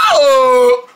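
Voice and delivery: high squeal of pain, half laughing; squealing crying wail. Acted. Toy figure struck, squealing in pain.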